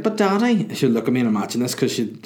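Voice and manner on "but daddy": high-pitched imitation of a little girl; toddler voice. Imagined baby daughter pleading with her dad, high childish voice. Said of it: "she'd be like".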